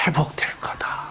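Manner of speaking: soft, breathy solemn whisper; heavenly figure assures the mother in a whisper; whispered promise. Acted.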